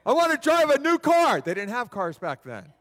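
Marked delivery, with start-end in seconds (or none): 0.1-1.4 loud, high comic demand; mock exasperated demand. Beggar sarcastically tells Jesus what he wants, loudly exasperated. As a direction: belt out the sarcastic wish.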